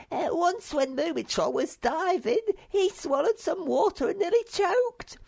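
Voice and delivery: very high, loud, gleeful little creature; squeaky Sniff. Acted. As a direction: squeal the story with glee. Sniff recounting diving mishap.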